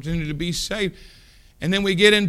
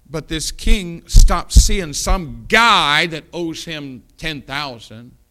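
slow emphasis; 3 semitones higher, 11 dB louder, slower than usual. Acted. Slowed, emphatic preaching.

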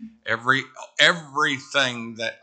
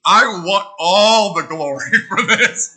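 high, loud, drawn-out mock boast; hammed-up little-kid glory grab, breaking into a laugh; glory-hungry child; 7 semitones higher, 8 dB louder, slower than usual. Preacher imitating a little girl proud of her reading, hammed-up boasting. Mocking the child's moment of pride.

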